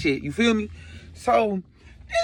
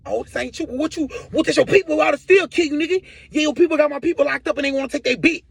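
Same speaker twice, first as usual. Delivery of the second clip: higher-pitched hectoring robber, acted out; lightly voiced accusing gunman; robber confronting victim; 8 semitones higher, 4 dB louder than usual. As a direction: press him hard in a raised voice. Acts the robber questioning him.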